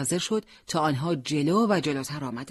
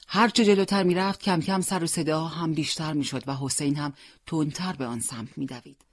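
ordinary delivery, nothing marked

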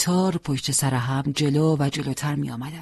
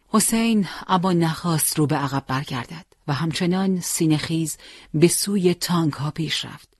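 usual speaking voice

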